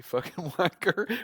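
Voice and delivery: amused quote with laughter in it; laughing insult. Real, not acted. Host recalls the guy's rude reply, laughing. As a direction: break into a laugh mid-insult.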